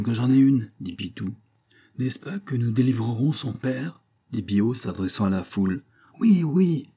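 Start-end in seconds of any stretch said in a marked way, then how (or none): 6.2-6.9 high, loud shout of assent; roaring crowd. A narrator's reading. Crowd answers the farmer's appeal, roaring high and loud. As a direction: roar agreement like a crowd. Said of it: "mugit la foule".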